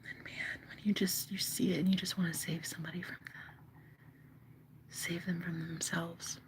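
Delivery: hushed, breathy confession; whisper. Real, not acted. Whispering to viewers about saving someone. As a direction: whisper it like a secret ache.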